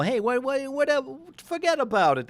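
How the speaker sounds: high-pitched New England mom voice; imitating mom. Forecaster mimicking his New England mom, high accented impression. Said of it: "That's my mom".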